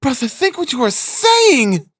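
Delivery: high, drawn-out indignant protest; impetuous. A narrator's reading. Sister protesting to her brother, high impetuous outburst. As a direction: burst out high, leaning on the last word. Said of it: "began impetuously".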